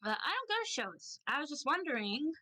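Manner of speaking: awkward little reply, voiced high; socially awkward Bath. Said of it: "they lean awkwardly onto their own hip".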